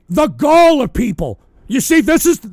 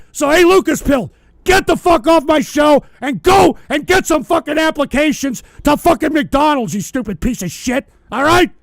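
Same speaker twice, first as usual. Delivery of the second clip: angry shouting; 3 semitones higher than usual. Real, not acted. Streamer ordering a chatter off his show, furious shout. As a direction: kick him out of the stream with a bellow.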